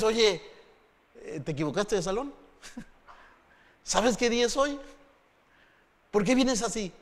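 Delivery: teasing, high and a little amused; mocking question. A touch of a mocking onlooker. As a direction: poke fun at the overdressed one.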